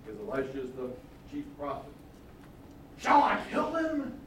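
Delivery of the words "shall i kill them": high, slow, eager ask; the king hoping for a yes; first asking of the king, keen; eager question. Acted. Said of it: "He really wants to kill them".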